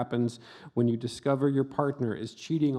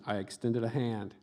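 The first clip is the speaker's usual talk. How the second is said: ordinary talk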